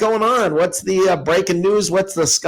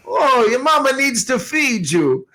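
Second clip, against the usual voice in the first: high, fussing Chicago Italian auntie voice; 9 semitones higher than usual. Retold or imitated. Aunt scolds her thin nephew, mock Chicago Italian accent. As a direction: fuss over him like his Chicago Italian aunt. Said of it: "she's like".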